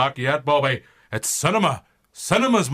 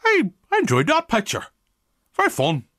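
high Scorsese voice; 11 semitones higher than usual. Acted. Marty's verdict on Iron Man.

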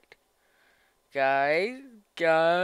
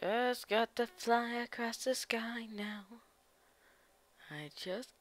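whispering; 2 semitones higher, 15 dB quieter, slower than usual. Real, not acted. Hushed while flying.

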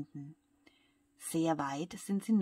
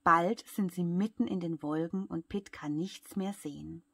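ordinary delivery, nothing marked

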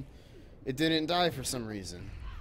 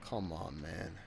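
softer, lower frustrated plea; exasperated under breath; 5 semitones lower, 7 dB quieter than usual. Real, not acted. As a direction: let it out quietly in defeat. Enemy escapes, frustrated player.

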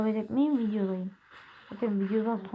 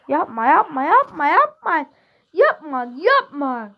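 drawn-out loud pleading; slow, loud begging yapma yapma; 5 semitones higher, 9 dB louder, slower than usual. Real, not acted. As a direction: beg the teammate to stop, loud and slow. Pleading in game.

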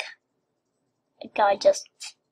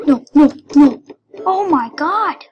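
alarmed outburst; 3 semitones higher, 9 dB louder than usual. Real, not acted. Gamer watching a goal slip away, loudly dismayed. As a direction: cry out as the game goes against you. Losing in the soccer game.

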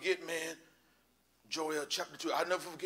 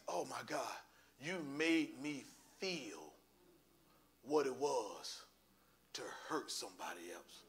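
very soft, low and slow; retold humbled murmur to God; hushed prayer; 5 semitones lower, 8 dB quieter, slower than usual. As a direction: let the realization sink in, almost under the breath. Retold humbled prayer after the vision.

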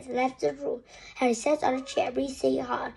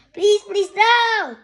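high, loud begging cries; frantic pleading; 8 semitones higher, 11 dB louder, slower than usual. A narrator's reading. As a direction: beg desperately at full voice. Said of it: "screamed Harry".

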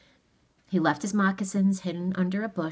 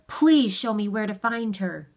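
louder, higher, blustering request; firm, raised-voice request; stern authority; 5 semitones higher, 6 dB louder than usual. A narrator's reading. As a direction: insist with put-on authority. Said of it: "put into his voice the stern".

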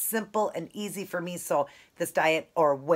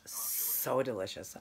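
slow breathy whisper of delight; whispered savoring; 4 semitones lower, 8 dB quieter, much slower than usual. Real, not acted. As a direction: whisper it slowly, savoring the bite. Savoring food on camera.

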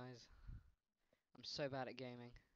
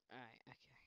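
whisper; 11 dB quieter than usual. Real, not acted. Quiet self-talk.

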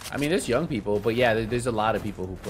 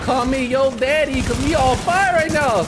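high, slow, loud bragging yell; hype shouting; 11 dB louder than usual. Real, not acted. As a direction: shout it like a victory boast. Bragging after kills.

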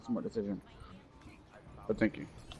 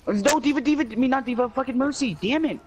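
frantic high yell; 13 semitones higher than usual. Real, not acted. Frustrated callout mid-fight.

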